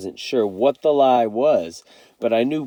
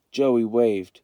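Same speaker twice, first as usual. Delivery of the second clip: usual speaking voice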